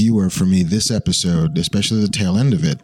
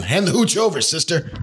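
higher-pitched, hammed-up demand; mock tough demand; 8 semitones higher than usual. Host asking co-host for the bottle, hammed-up demand. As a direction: play-act demanding the bottle.

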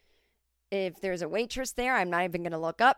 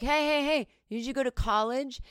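put-on snobby rich young man; 5 semitones higher than usual. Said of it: "would be like".

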